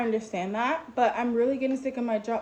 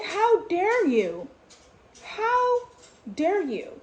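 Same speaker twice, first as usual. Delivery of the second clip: high, drawn-out outrage, louder at first; retold yelling; 9 semitones higher, 4 dB louder, much slower than usual. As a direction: confront her with an indignant "how dare you". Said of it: "I'm, like, literally yelling".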